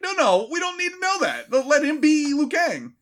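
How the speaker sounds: very high, squeaky objection; pleading protest. Acted. Fan objecting to a makeover pitch, high pleading protest. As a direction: plead in a high mock-distressed voice.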